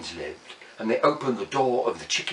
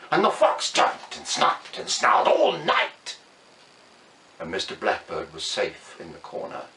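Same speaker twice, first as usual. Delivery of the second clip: usual speaking voice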